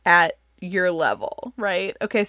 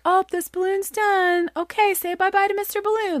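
mock cheery parent; 11 semitones higher than usual. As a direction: sweetly wave the balloon goodbye like talking to little kids. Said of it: "where you can be like".

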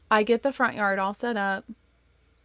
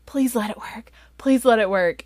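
whispery plea, then louder repeat; hopeful pleading; 4 semitones higher, 6 dB louder than usual. Retold or imitated. Two older sisters as a car approaches, hopeful pleading. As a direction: wish hard, first under the breath, then aloud.

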